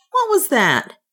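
high-pitched, startled nervous question. A narrator's reading. Mick hearing ice clatter behind the door, nervously. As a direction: ask it jumpily, voice jumping up. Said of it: "Mick's voice came nervously".

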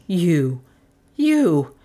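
stammering. A narrator's reading. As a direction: stammer the accusation, pausing between words. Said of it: "he stammered at the doctor".

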